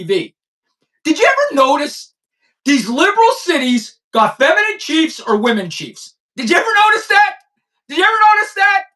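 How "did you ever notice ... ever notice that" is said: high mocking question. Real, not acted. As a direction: needle them with a high question. Repeated high taunting question.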